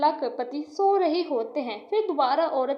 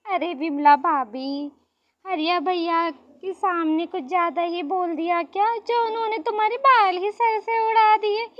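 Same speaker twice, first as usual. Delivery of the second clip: raised, loud teasing of a rival; mocking neighbour; 4 semitones higher, 5 dB louder than usual. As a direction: taunt her with relish. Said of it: "उस पर हंसती है".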